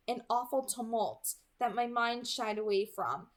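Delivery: plain reading voice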